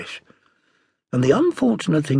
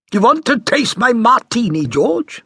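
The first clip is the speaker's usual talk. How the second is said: mother's voice; 10 semitones higher, 6 dB louder than usual. A narrator's reading. Mother offers boy a martini.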